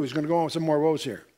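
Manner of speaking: ordinary delivery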